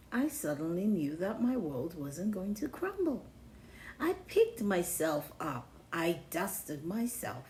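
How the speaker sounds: usual speaking voice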